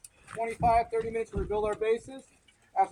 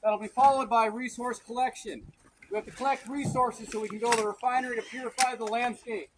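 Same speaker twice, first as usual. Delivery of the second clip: drill sergeant; 6 semitones higher, 5 dB louder than usual. Acted. Commander briefing continues in character.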